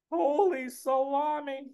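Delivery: high, slow Brooklyn amazement; stunned, drawn-out Brooklyn squeal; Uncle Murray. Acted. Uncle stunned by the movie offer, high Brooklyn accent. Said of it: "Me, I give him a Brooklyn accent".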